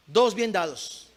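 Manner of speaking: "Dos bien dados" said slowly, level voice; slow, measured statement. Real, not acted. First take, slow measured statement.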